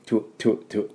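"トゥ" as short tw bursts; quick isolated "トゥ" drills; double consonant. Shown as a demonstration. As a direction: puff out each "トゥ" with t and w fused. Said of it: "トゥエって言わないように。".

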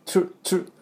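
チュ as a breathy "ch"-like tr cluster with no voice; チュ, a whispered tr burst repeated; tr sound drill. Shown as a demonstration. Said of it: "TですぐRに持っていかなきゃいけないんで". (「チュ」をささやくように)

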